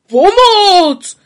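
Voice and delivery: very loud, high cry for help; shout for help. A narrator's reading. Pytlík screams for help clinging to the racing cart. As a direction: yell in panic as the cart bolts. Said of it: "křičel Pytlík".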